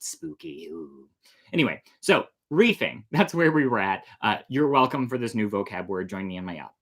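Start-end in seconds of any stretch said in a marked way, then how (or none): none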